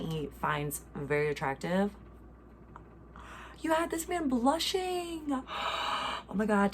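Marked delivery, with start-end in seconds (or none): 3.6-5.4 high, drawn-out fangirl gushing; high-pitched, stretched-out swoon; gushing squeal. Real, not acted. Gushes over fan's story. 5.5-6.2 swooning gasp; big breathy intake. Real, not acted. Overcome by cute story.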